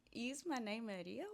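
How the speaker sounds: slow question, "Rio" swinging up at the end; asking a question. Shown as a demonstration. Coach spelling out what the rising answer sounds like, questioning rise. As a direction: ask it slowly with a clear rise on "Rio". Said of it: "I'm asking the question".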